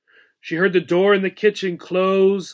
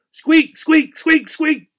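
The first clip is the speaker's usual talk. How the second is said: high, loud squeaks; playful high squeaking; squeaky wheel noise; 11 semitones higher, 6 dB louder, slower than usual. A narrator's reading. Hamster sprinting on squeaky wheel.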